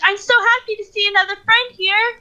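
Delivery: lightly voiced, glad to meet a fellow halfling; happy halfling greeting. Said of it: "I start talking in halfling".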